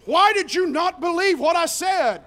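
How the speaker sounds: high, loud scolding shout; rebuke. Acted. Risen Jesus scolding the disciples, loud rebuke. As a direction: call them out hard.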